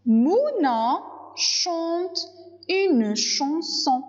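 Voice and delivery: "Mouna" haut, phrase très lente et détachée; détache chaque syllabe pour les élèves; lecture syllabée. Shown as a demonstration. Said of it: "syllabe par syllabe". ("Mouna chante une chanson" très lentement, haut)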